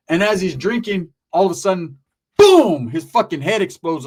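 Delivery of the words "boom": loud, high impact noise; explosive boom. Retold or imitated. Storyteller acting the rock hitting, explosive loud boom. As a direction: burst it out like a sudden blast.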